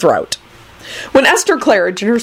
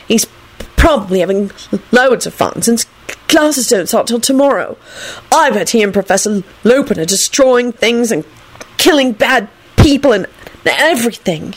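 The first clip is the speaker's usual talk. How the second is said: sullen; 5 semitones higher than usual. Acted. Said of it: "he finished sullenly".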